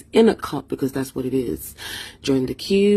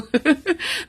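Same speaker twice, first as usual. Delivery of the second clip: laugh; 11 semitones higher than usual. Real, not acted. Laughs after imitating vendor.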